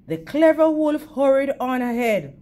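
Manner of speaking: ordinary delivery, nothing marked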